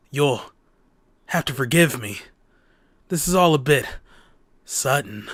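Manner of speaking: lightly voiced hesitant man, pitched low; a hint of low, awkward acceptance; reluctant Osawa.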